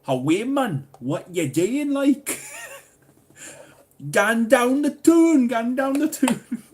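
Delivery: high, loud hammed-up Geordie; Geordie accent. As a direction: shout it in broad cartoon Geordie. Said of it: "talking Geordie".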